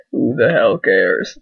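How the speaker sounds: dismissive. Acted. Dismissing dead character.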